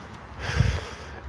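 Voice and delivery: soft, breathy out-breath letting tension go; long release sigh. Real, not acted. Letting go of tension while walking, a deep sigh. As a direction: sigh it all out, slow. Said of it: "I can feel the tension in my body".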